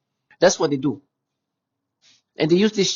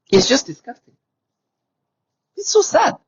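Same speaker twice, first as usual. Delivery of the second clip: disgusted outburst; 11 semitones higher, 6 dB louder than usual. Real, not acted. Sudden high disgusted outburst.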